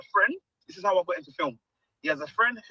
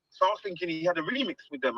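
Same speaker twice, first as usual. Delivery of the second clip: ordinary talk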